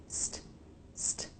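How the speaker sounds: "St" whispered with a crisp true T; "st" breathed out with a sharp T. Shown as a demonstration. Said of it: "true T's".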